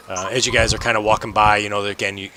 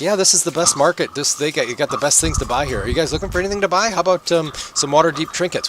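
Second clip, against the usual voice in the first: higher-pitched, rambling market hawker; chattering salesman voice, raised in pitch; street vendor calling out; 6 semitones higher than usual. Acted. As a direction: pitch your wares to passers-by. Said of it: "kinda calling out".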